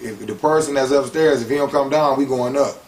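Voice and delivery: imitated official warning; retold police warning. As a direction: act out an officer's ultimatum, projecting. Police threaten to go upstairs.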